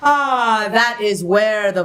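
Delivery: yawned "Ah" sliding into speech; yawning placement. Shown as a demonstration. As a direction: open the throat in a yawn as you talk. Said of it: "How to find it is yawning".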